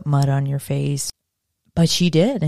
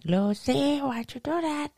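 lightly put-on Cuban accent, much higher, softer; 10 semitones higher, 7 dB quieter than usual. Imitating Ricky scolding Lucy, a bad Cuban accent. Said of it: "that was a terrible [name] impression".

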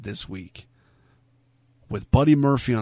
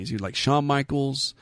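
ordinary talk

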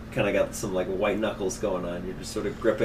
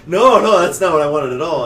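loud, high alarmed protest; 6 semitones higher, 11 dB louder than usual. Retold or imitated. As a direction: cry out in dismay. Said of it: "you're like".

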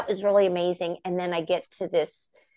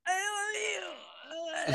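awed gush; 10 semitones higher than usual. Retold or imitated. Fan to her idol, high awestruck gush. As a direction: gush at your idol, barely able to speak. Star-struck gush to Sherry Lewis.

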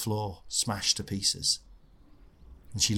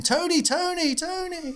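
teacher calling; slower than usual. Retold or imitated. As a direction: call after a runaway pupil. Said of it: "she went running after him".

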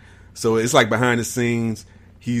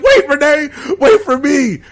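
much higher, panicky plea; mock pleading call; 13 semitones higher, 4 dB louder than usual. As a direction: whine after her to wait. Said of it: "she's like".

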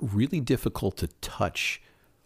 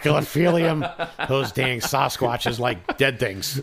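hammed-up goofy voice; 8 semitones higher, 5 dB louder, slower than usual. Joking about Sasquatch, hammed voice.

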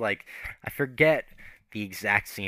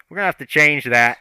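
louder mock shout from off camera; retold yell; 4 semitones higher, 8 dB louder than usual. Crew member shouting from behind the camera, louder. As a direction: call it out loud like a crew member interrupting. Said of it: "yells from behind the camera".

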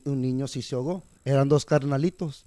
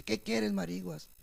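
higher-pitched retold reply; lightly voiced older co-worker; 6 semitones higher, 7 dB quieter, slower than usual. Cleaning lady answering the worker by nickname, lightly imitated. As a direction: lift the pitch to quote her. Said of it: "así me llama la señora".

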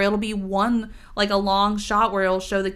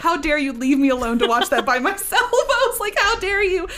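laughing, much higher mock indignation; mock outrage; 7 semitones higher, 4 dB louder than usual. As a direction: scold your friend in playful fake fury. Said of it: "And I was like".

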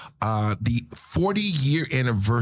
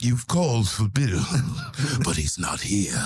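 lightly voiced spooky narrator; a hint of Vincent Price menace; 3 semitones lower than usual. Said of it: "legendary voice that is like the spooky narrator".

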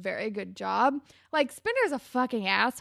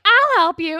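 loud, higher imitation of a character; eager mock outburst; 7 semitones higher, 13 dB louder than usual. Retelling Hazel jumping in to help, eagerly loud.

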